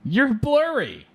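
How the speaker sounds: very high, squeaky mock wail; mock complaint. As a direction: whine it way up high, drawn out at the end. Mock protest voiced while laughing.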